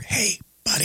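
hushed whisper. Retold or imitated. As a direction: whisper like a co-conspirator. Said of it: "my dad every once would".

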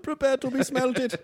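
high, slow mock German villain. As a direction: threaten slowly in a high German accent. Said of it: "that's his German evil laugh".

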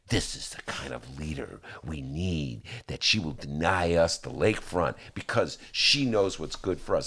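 low, hushed-down admiring quote; mock reverence. Mocking Northsiders' reverence for mayor.

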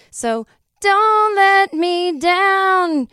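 slow, high, loud drawn-out plea; hammed-up desperate plea; mock pleading wail. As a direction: stretch every word into a begging howl. Pleading with audience to come.

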